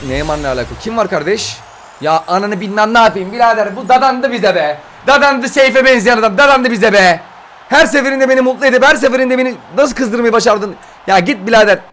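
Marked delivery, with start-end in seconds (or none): 2.0-11.8 high-pitched, frustrated complaint; exasperated ranting. Real, not acted. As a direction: rail at the unwanted player. Angry at a repeated player. (sinirli sitem)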